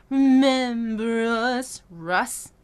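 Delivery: "Remember us" slow, sliding into "Russ"; linked R to S. Shown as a demonstration. Coach demonstrating linking. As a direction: carry the R into the S. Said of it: "Link the R to the S".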